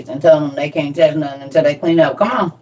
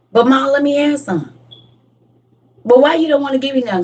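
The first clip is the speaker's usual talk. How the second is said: put-on higher pleading kid voice; whiny kid; 6 semitones higher than usual. Kid whining at mom for a bite.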